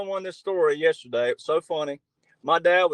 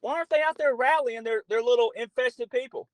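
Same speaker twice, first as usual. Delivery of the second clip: normal talking voice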